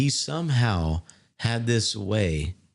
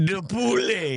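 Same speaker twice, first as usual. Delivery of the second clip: much higher, hammed-up French accent; joking French accent offering chicken; mock French accent; 12 semitones higher, slower than usual. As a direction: serve it up like a cartoon French waiter. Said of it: "You want some chicken, huh?".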